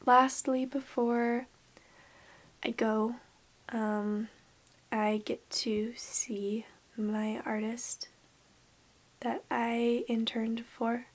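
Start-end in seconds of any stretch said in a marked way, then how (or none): 4.9-11.0 whisper. Real, not acted. Whispered excitement.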